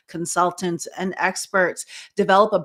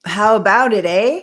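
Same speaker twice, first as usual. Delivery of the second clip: loud, hammed-up Canadian "eh"; mock Canadian accent; 3 semitones higher, 10 dB louder than usual. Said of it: "making fun of her Canadian accent".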